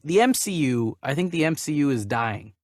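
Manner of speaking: ordinary delivery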